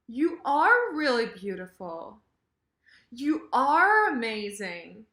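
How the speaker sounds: "are" stretched and punched up; emphatic "are". Shown as a demonstration. Teacher agrees, emphasizing verb to be, stressed "are". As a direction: lean hard on "are" in each compliment. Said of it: "emphasizing the verb to be".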